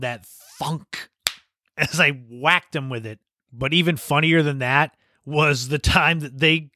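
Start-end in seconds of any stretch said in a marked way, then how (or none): 0.3-1.1 soft, hollow-thud imitation of impact; word mimicking a dull impact; imitated thud. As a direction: say it softly as the sound of a hit. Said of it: "you could hear that sound".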